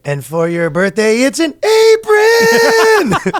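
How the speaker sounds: slow, rising to a very high squeal; mock parent. Mocking a parent's birthday gift, squealing. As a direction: draw it out then squeal.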